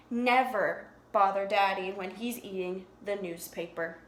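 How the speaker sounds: usual speaking voice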